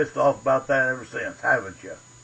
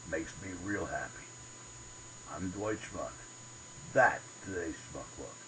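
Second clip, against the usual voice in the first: much lower, much softer closing words; quiet sign-off; 10 semitones lower, 14 dB quieter than usual. Acted. Host signing off his commentary, quiet outro. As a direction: wind down the show gently.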